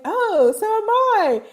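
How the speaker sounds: very high, exclaimed Southern-accent imitation; imitated Southern accent. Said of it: "guy who had a Southern accent".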